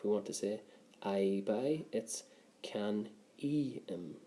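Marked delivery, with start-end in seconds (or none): 2.6-4.2 can e im said slowly, each syllable apart; slow spelled-out demo. Shown as a demonstration. Teacher to class showing the ending, can e im said slowly. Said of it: "Notice that there's a fara on the I".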